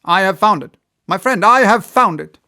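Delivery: high, loud triumphant cry, rising further; excited cry. A narrator's reading. Inventor telling his friend of his find, triumphant shout. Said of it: "he cried at last".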